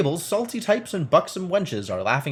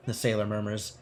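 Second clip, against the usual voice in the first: ordinary talk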